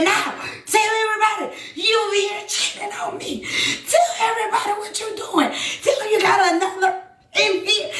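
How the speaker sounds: high-pitched retold yell; heated accusation. Woman exposing cheating partner from the street, heated accusation.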